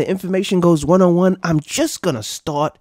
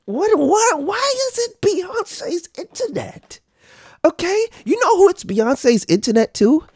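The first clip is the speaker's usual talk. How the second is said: high, incredulous mocking voice; mock outrage; 13 semitones higher than usual. Streamer mocking the [name] line, pitched way up. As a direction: squeal the question in disbelief.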